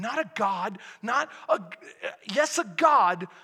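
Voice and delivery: ordinary talk, nothing marked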